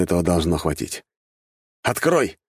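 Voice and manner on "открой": high-pitched, falling order; emphatic command; insistent command. A narrator's reading. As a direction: order it firmly with raised voice. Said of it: "повторно приказал я".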